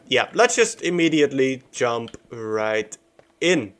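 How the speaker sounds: normal talking voice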